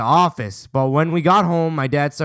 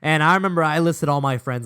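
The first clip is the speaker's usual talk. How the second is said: usual speaking voice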